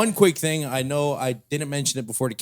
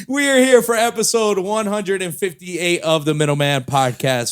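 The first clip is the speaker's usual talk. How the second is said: loud, hyped show announcement, higher and louder; podcast host intro; 5 semitones higher, 7 dB louder than usual. Acted. Host hyping the episode to listeners, loud announcer voice. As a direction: boom out the episode number like a host.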